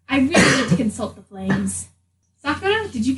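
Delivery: ordinary talk, nothing marked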